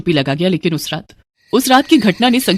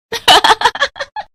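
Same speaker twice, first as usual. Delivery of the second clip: very high, teasing giggle; giggling laugh; 14 semitones higher than usual. Acted. Sister-in-law teasing him about the lizard, giggling. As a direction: burst out giggling at your own joke. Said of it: "वो एक बार फिर से हंस पड़ी".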